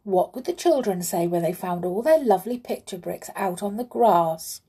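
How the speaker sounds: ordinary delivery, nothing marked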